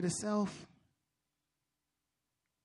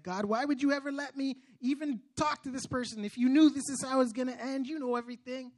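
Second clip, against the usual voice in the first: higher, louder aggrieved plea to God; frustrated; 6 semitones higher, 5 dB louder than usual. Retold or imitated. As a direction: demand answers, re-enacting old frustration. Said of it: "I was frustrated".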